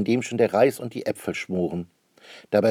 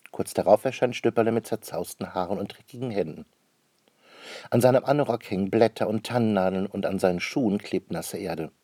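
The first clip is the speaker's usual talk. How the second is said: ordinary delivery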